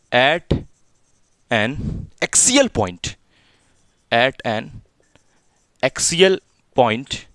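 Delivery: very slow, spaced-out dictation; measured words with long pauses; dictating. Real, not acted. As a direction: dictate it piece by piece for writing. Dictating corrected heading to students.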